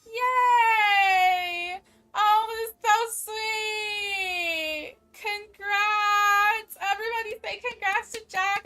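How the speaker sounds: loud, high, slowed cheering; ecstatic congratulations. Real, not acted. Streamer cheering for an engaged viewer, loud and high. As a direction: shout congratulations with tears near.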